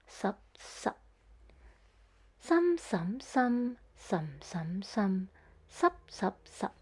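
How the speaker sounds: some and sup in nine tones, sup cut short; Cantonese tone demo. Shown as a demonstration. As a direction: show each tone on some, stop sup abruptly. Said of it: "Those words sound like this".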